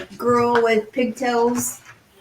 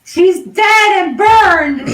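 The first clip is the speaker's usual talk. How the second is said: put-on child's outburst; 8 semitones higher, 7 dB louder, much slower than usual. Grieving child character shouts.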